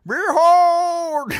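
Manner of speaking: loud, high herald cry; hammed-up call to unveil the sword; a hammed-up fantasy herald crying out; mock epic proclamation. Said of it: "I'm just going".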